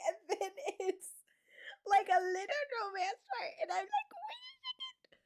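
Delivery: high, squeaky voice through real laughter; laughing so hard it nearly sounds like crying; helpless laughter. Real, not acted. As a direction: try to talk while cracking up. Overcome with laughter while retelling.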